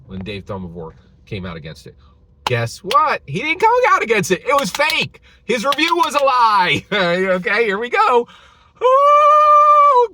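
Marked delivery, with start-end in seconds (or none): 2.4-8.3 much higher and louder, fast, gleeful outrage; excited rant. Real, not acted. Ranting reveal of the fake review. 8.8-10.1 a high, loud, mocking "ooh". Real, not acted.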